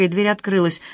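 usual speaking voice

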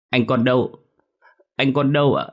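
put-on a child on the verge of tears; whimpering child. A narrator's reading. Boy asks aunt where brother is, whimpering. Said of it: "Quang mếu máo".

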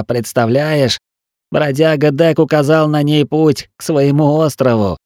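rambling feverishly; feverish wounded man. Acted. Wounded Til talks in fever.

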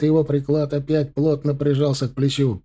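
plain reading voice

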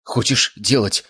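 put-on mild exclaimed question from a girl; alarmed exclamation. A narrator's reading. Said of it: "воскликнула девушка". (сдержанный девичий возглас)

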